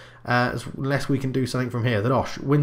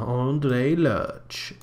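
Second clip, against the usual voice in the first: sultry, slow and seductive name drop; sexy porn star voice. Acted. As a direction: purr the name like a seductive announcer. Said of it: "I said that like a sexy porn star".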